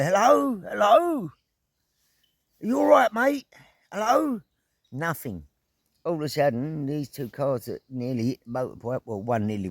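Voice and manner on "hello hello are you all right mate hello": put-on high, loud English-accented call. Rider checking on the injured person, loud English accent. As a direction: call out in exaggerated English, voice pitched right up. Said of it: "give him an English accent".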